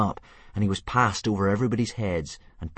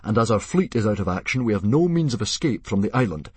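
chief addressing tribe; 4 semitones higher, 6 dB louder than usual. Acted.

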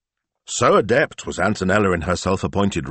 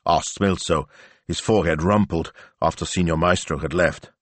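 usual speaking voice

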